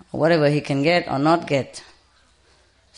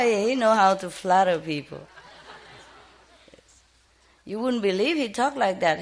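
usual speaking voice

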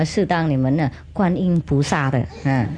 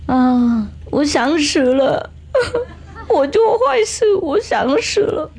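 hammed-up crying confession, much higher; a naughty boy pretending to weep; mock sobbing; 8 semitones higher, slower than usual. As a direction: fake wailing through laughter. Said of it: "在那边哭了，啊，哭得很大声啊".

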